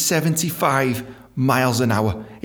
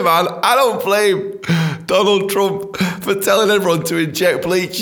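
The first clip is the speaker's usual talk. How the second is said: ordinary delivery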